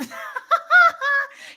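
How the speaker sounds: loud high cackle; big burst of laughter. Real, not acted. Reacting to license plate.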